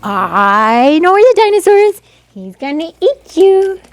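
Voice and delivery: high, loud playful monster tease; playful teasing. Pretend. Photographer teasing toddler with dinosaur game, playful. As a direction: pretend to gobble the kid up.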